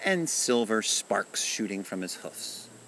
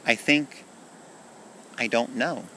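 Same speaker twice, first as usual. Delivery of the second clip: higher-pitched, halting admission of not knowing; a touch of sadness, with a long pause; sad, hesitant; 5 semitones higher than usual. A narrator's reading. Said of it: "he said sadly".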